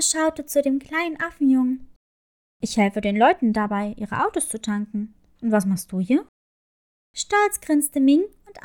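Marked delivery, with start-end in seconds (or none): 2.6-6.3 low, kindly crab character; friendly crab voice. A narrator's reading. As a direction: speak as a gentle low crab.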